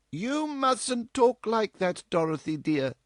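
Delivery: high, slow, firm mother; earnest. A narrator's reading. Mother gently but firmly checks her daughter, earnest. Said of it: "that which told her daughter she was in earnest".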